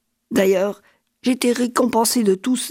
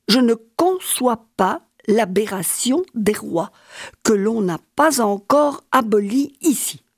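ordinary talk, nothing marked

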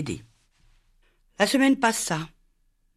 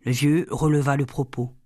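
ordinary delivery

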